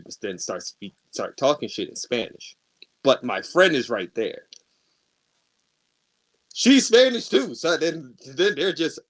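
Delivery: usual speaking voice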